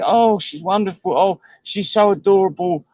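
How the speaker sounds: lightly voiced smitten man, hammed-up; mock gushing. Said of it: "And he says".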